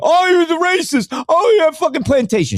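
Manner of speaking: high, whiny mock accusation; mocking accusers. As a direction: sneer like a self-righteous critic. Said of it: "a lot of people go".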